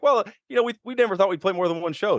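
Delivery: high-pitched, hedging mock explanation; sheepish explaining. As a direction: act out an awkward excuse. Acting out awkward band explanation.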